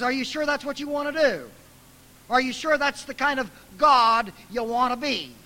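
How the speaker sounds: high, loud, doubting questions; incredulous challenge. Retold or imitated. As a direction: press God to reconsider. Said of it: "little theological seminar for God".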